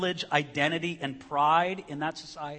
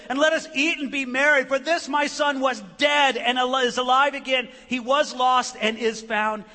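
high, loud, ringing father's announcement; joyful proclamation; 7 semitones higher, 7 dB louder than usual. Acted. Preacher voicing the father welcoming his lost son, joyful proclamation. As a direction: proclaim it at full voice, jubilant.

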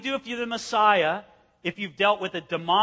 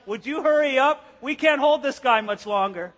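strained friends; 5 semitones higher, 7 dB louder than usual. Retold or imitated. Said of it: "They're like".

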